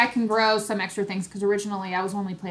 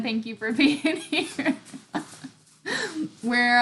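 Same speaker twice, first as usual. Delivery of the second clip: normal talking voice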